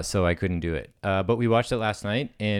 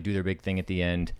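usual speaking voice

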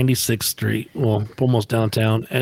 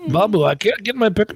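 higher-pitched put-on pleading; prank caller voice; 8 semitones higher than usual. Leaving Babu a prank message, higher put-on voice. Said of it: "I left a message like this".

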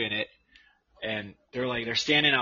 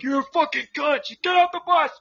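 retold shouting; 4 dB louder than usual. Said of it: "he's like".

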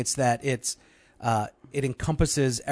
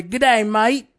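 raised, punchy Aussie-style hello; hearty greeting; 7 semitones higher, 5 dB louder, slower than usual. Acted. Podcast host greeting an Australian listener on request, hearty. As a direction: give a big hearty hail. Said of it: "give them a hearty".

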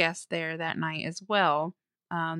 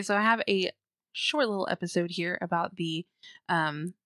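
normal talking voice

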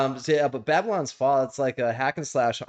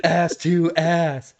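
slow, slightly raised mock chant; mock yelling; 5 semitones higher, much slower than usual. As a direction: imitate the old men's drawn-out cry. Joins in imitating the yelling.

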